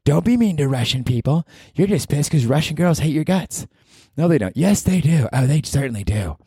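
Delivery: high scolding other self; a hint of a nagging alter ego; scolding inner voice. His other self scolding him about Russian girls, high and snippy.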